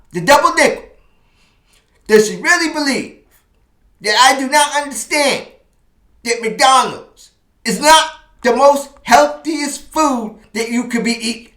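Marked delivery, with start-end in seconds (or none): 2.1-11.5 high, loud, exasperated protest; high, loud, wounded indignation; indignant rant. Real, not acted. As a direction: pitch up high and loud in offended disbelief. Indignant rant at girlfriend's lecture.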